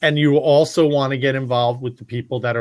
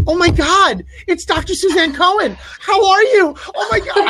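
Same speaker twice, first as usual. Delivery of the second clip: mock gushing fan. Speaker mocking fans by gushing back at them, very high. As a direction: turn the fangirling right back on them. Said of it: "and I went".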